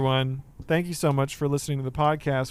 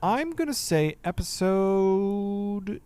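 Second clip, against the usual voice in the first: slow dramatic reveal; 5 semitones higher, much slower than usual. Acted.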